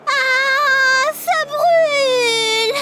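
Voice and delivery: very high, long drawn-out shriek; sustained high wail of pain; burning-mouth scream. Acted. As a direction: cry out as if the mouth is on fire. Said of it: "quelqu'un a crié dans la cuisine".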